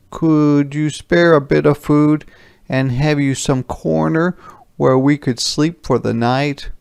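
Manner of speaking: begging soldiers. Acted.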